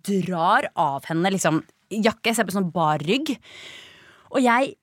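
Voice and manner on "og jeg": ordinary talk, nothing marked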